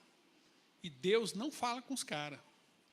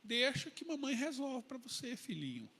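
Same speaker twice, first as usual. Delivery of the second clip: doting mother Jezabel; 8 dB quieter than usual. Retold or imitated. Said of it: "Jezabel fala assim pra ele".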